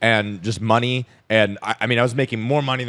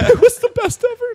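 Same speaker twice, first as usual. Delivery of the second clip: much higher, louder laughing joke; giggly mock boast; laughing sarcasm; 11 dB louder than usual. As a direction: crack up while bragging sarcastically. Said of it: "was that good?".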